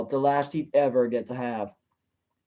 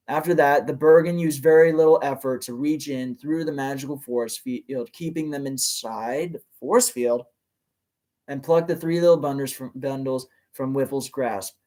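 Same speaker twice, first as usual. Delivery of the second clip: usual speaking voice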